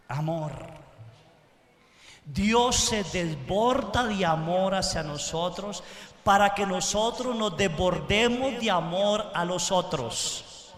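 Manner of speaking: normal talking voice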